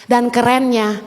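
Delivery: ordinary talk, nothing marked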